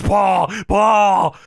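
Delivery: acted calling out. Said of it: "gets to the back and says".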